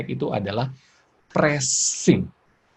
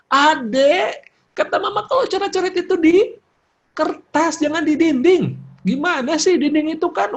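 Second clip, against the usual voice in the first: high-pitched acted parental telling-off; scolding mother; 5 dB louder than usual. Mother scolds child for drawing on walls, acted high scolding.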